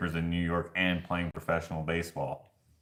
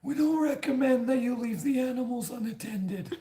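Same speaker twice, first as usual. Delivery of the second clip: a lightly voiced, higher, stiff desk clerk; mock polite front desk guy; hotel clerk voice. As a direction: play the prim hotel clerk reading policy. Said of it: "he's like".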